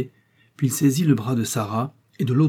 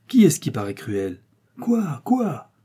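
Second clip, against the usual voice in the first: les «Quoi?» lancés très haut; crie-le dans une terreur soudaine; s'écrier, prise de peur; 4 semitones higher, slower than usual. A narrator's reading. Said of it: "s'écria Sarah". (épouse saisie d'effroi, cris aigus)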